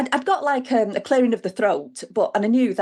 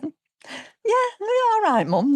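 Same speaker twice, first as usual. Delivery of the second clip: pitched-up, humouring son's voice; imitating her son; 14 semitones higher, much slower than usual. Mother mimicking her son humouring her about Snowdon, mock indulgent. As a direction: humour her like she's daft. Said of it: "looking at me as though I was daft".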